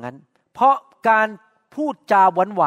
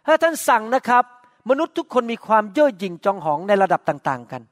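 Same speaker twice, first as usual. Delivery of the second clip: normal talking voice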